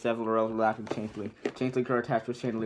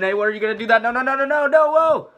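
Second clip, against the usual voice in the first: higher and louder into a terrified scream; panicked scream; 12 semitones higher, 12 dB louder than usual. Acted. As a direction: shriek as you're dragged away. Donkey dragged off by the dragon.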